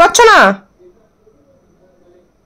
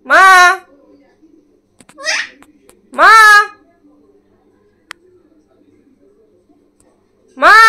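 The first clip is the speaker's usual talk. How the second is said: hoarse calling; 9 semitones higher, much slower than usual. Real, not acted. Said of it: "ভাঙা গলায় তোমাকে মা মা করে যাচ্ছে".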